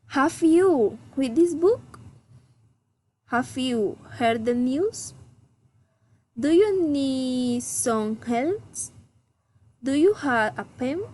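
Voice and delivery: yes-no questions that climb at the end, as on "Have you read this book?"; rising intonation. Shown as a demonstration. Teacher models general questions, rising intonation. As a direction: lift "book", "news", "help" and "pen" upwards. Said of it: "raising intonation".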